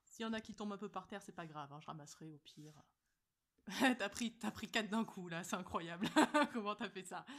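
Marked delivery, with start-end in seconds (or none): 3.7-7.2 loud laughing disbelief. Real, not acted. Laughing loudly at the blindfolded friend's clumsy grab. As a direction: laugh through it, amazed.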